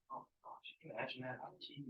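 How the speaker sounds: whispered, appalled disbelief; a whispered gasp of disbelief to the class; hushed aside. Real, not acted. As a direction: lean in and whisper it, shocked. Teacher reacts to pregnant wife shot.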